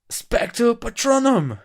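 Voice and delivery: high, booming drawn-out incantation; mock-epic spell, very loud and high; shouted spell. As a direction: bellow it out slowly, every syllable stretched.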